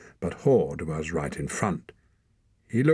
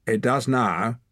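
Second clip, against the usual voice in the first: put-on young man snapping back, higher; snapped; 5 semitones higher, 4 dB louder than usual. A narrator's reading. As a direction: snap back sharply. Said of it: "snapped the young man".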